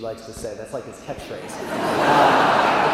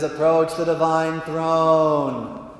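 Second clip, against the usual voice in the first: slow, intoned divine command; God; 4 dB louder, much slower than usual. Acted. God summons paralyzed visitors, slow and majestic. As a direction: hold the last word long and grand. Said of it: "that's how he talks".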